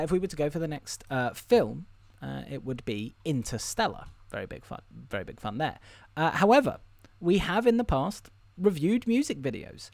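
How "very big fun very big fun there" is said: very soft deadpan aside; half-whispered sarcastic aside; dry mutter. Real, not acted.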